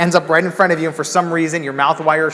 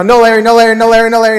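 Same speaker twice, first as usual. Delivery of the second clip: louder, rising urgency, no Larry; searching each aisle, getting louder; frantic repeated calling; 5 semitones higher, 7 dB louder, faster than usual. Retold or imitated. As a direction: chant it faster as panic builds.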